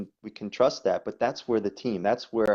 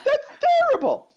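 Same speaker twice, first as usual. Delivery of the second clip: mock outrage; 7 dB louder than usual. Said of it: "people writing it".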